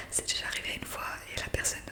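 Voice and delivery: ordinary talk, nothing marked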